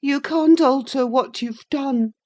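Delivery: put-on reply sulkily in a high child's voice; pettish. A narrator's reading. Linton shrinks from his cousin, high pettish reply. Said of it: "he replied pettishly".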